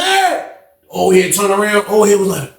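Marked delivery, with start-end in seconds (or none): 0.0-0.5 calling out. Retold or imitated. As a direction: call after someone in a retold scene. Calling out to someone by name.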